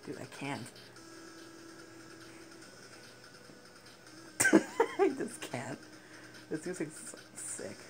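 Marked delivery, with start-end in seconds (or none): 0.7-4.9 long, wheezy silent laugh; real breathless laughing fit; helpless laugh. Real, not acted. Cracks up at the game. 5.0-5.8 high, laughed-out words; real giggle breaking up the words; laughing. Real, not acted. Cracking up at the game.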